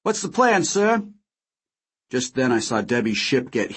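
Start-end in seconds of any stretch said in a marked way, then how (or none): 0.0-1.0 put-on eager young pilot. A narrator's reading. Character voice in read story.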